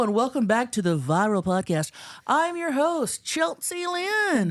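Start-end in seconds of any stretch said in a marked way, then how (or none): 2.3-4.5 host intro. Acted. Sing-song show intro.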